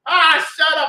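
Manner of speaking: high, loud mock cartoon snap; cartoon shut up. Chicken hawk silencing the yapping rooster, loud and high. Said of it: "he just said".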